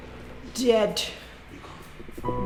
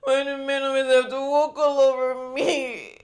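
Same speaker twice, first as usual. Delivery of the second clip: sobbing, wailed lament; crying; 4 semitones higher than usual. Acted. Woman breaks down to brother-in-law about men, crying. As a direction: cry the questions out in anguish. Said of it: "Now you're crying".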